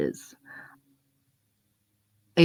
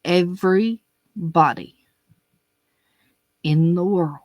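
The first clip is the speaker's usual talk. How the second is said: ordinary talk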